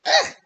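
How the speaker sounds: high, loud retching ugh; disgusted ugh. Retold or imitated. Recalling the drink's taste, high loud disgusted ugh. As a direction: spit it out like the taste is awful. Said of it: "I'm like".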